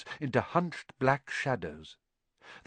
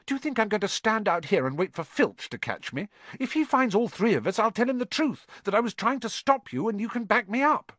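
put-on high-pitched, insistent girl's protest; indignant arguing; 10 semitones higher, faster than usual. A narrator's reading. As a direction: argue back in a high huff. Girl argues indignantly with the boys.

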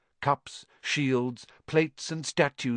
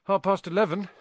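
ordinary delivery, nothing marked

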